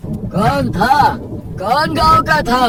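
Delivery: high, insistent uncle voice; questioning village elder. Acted. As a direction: press him for the stranger's identity.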